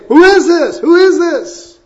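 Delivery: high, amazed calling out; wondering crowd. Retold or imitated. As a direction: ask it like an awed onlooker. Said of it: "and they say".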